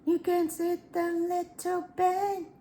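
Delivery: high, slow cooing to a pet; high sing-song talk to little Ben; sing-song baby talk. Pretend. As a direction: coax him down sweetly. Playful voice to a pet.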